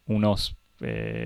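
normal talking voice

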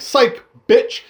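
high, loud mocking taunt; taunting psych. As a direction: jeer it at her as you fire. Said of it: "And he's like".

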